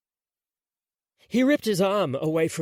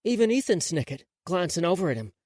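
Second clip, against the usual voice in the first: ordinary delivery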